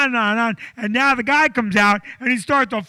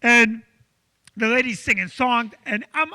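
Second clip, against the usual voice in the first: ordinary talk, nothing marked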